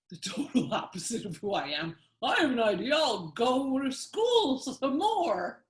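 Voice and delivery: lightly voiced imitation of the guest with an accent; mocking impression. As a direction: tease him in a put-on voice. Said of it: "I don't talk like that".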